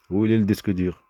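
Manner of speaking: real angry shout. Real, not acted. Speaker losing their temper over the hard drive, shouting. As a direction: blow up and demand the answer. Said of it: "il faudrait que je me calme".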